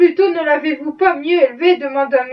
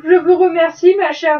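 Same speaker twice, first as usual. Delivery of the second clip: plain reading voice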